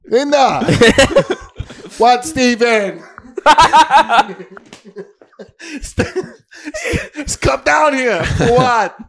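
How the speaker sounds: high proud father calling out. Acted. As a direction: call the wife downstairs. Proud dad calling wife downstairs.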